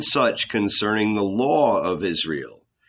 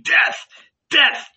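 louder, much higher cry; bay for his death; shouting; 5 dB louder than usual. A narrator's reading. Elders ripping their clothes, louder rising shouts.

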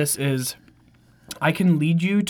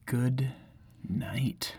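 soft fading goodnight, last word a whisper; quiet goodnight; 6 semitones lower, 10 dB quieter than usual. Acted. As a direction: hush it down to a whispered night. Sleepy goodnight, final episode.

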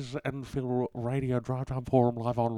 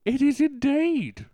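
high, loud and bright with glee; excited radio host; 6 dB louder than usual. Acted. Radio host answers caller, high and loud. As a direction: burst in like an overeager DJ.

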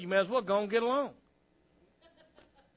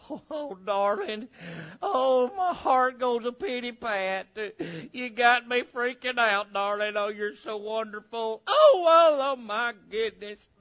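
hammed-up swooning lover; lovestruck sweetheart; 4 semitones higher, 5 dB louder than usual. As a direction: gush and sigh over your darling, mock-breathless with love. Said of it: "that first love's that hot, smoochy, smoochy".

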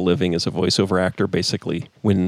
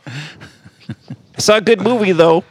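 high, slow put-on caller voice; mock caller; 6 semitones higher, slower than usual. Host as caller chatting about a movie, high. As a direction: caller changes topic, still in voice.

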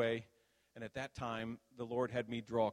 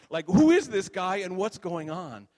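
loud, higher, incredulous demand; baffled angel; 6 semitones higher, 14 dB louder than usual. Retold or imitated. The angel asking Bob about the stranger, loudly baffled. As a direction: blurt it out in loud bewilderment. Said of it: "So the angel looks at Bob and says".

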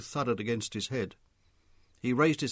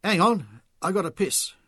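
put-on loud, higher drunk announcement; sudden announcement; 6 semitones higher, 7 dB louder than usual. A narrator's reading. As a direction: blurt it out suddenly. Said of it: "he suddenly announced".

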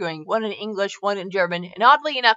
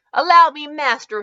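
raised, louder servant's offer; eager assistant addressing the master; lightly voiced hunchback servant; servile henchman; 6 semitones higher, 6 dB louder than usual. A narrator's reading. Hunchback servant offers to carry bag.

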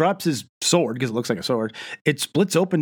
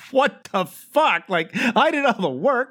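high-pitched, drawn-out disbelief; exaggerated incredulous complaint; mock indignant dad; 10 semitones higher than usual. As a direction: play an affronted father, voice pitched way up. Imitates the indignant dad's reaction.

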